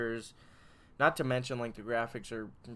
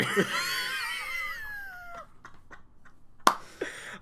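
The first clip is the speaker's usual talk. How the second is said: laughing fit. Real, not acted. Breaks up mid-line.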